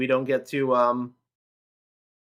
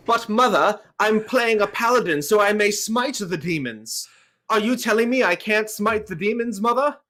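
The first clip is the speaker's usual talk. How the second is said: hammy posh English son, pitched up a bit; mock English accent; 6 semitones higher than usual. Kid in the eighties pleading with his mother, mock English accent. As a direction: play a pious English boy pleading, higher. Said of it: "That accent was hot".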